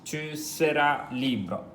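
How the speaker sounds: slow, careful French line; slow model reading. Shown as a demonstration. Teacher modelling the line for students, slow and careful. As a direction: say each word slowly for learners. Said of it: "So the first line".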